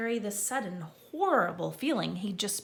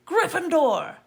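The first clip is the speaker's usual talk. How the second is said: loud, high triumphant call; hat shouting; 9 semitones higher, 10 dB louder than usual. A narrator's reading. Sorting Hat finally shouting Neville's house. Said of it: "When it finally shouted".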